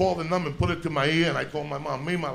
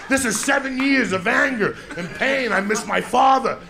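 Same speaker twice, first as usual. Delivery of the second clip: usual speaking voice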